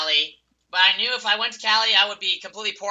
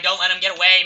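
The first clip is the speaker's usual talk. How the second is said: normal talking voice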